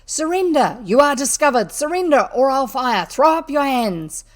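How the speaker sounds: loud high commanding shout. A narrator's reading. Robber bursting into the kitchen, loud commanding shout. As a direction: bellow the surrender order at the burglar. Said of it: "shouting out".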